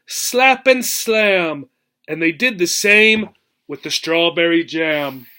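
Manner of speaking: lively storybook read-aloud, rhythmic and bouncy; expressive picture-book reading; reading to children. Acted. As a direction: read the rhyme to a class with relish. Teacher reads picture book aloud.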